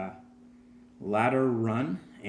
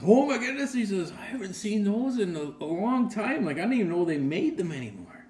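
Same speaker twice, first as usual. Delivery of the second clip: surprised grandpa; 9 semitones higher than usual. Retold or imitated. Grandpa sees old candy cigarettes.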